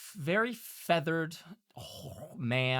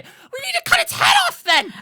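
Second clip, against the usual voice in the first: very high, loud shrieking imp; 14 dB louder than usual. Acted. Imp with a dagger shrieking at the monster's body. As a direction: screech it out with the dagger raised. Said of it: "walk over to the boublage and say".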